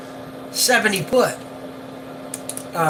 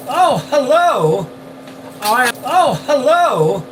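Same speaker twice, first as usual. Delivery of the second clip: surprised greeting; 7 semitones higher, 4 dB louder, slower than usual. Real, not acted. Greeting viewers on live stream.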